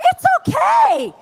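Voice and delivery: high, loud, drawn-out mock shout; breezy dismissal belted out high; quoting Trump. Trump waving off Secret Service, a high loud mock shout. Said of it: "And he is like".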